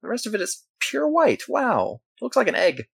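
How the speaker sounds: high, breathy-sounding gushing over a cute pet; gushing shop staff. Acted. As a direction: squeal with delight like a pet store clerk. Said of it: "They're like".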